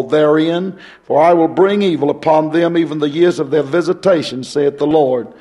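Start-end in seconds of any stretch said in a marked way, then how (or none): none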